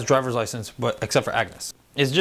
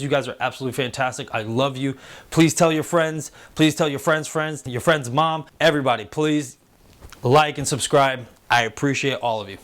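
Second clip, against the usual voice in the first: ordinary talk, nothing marked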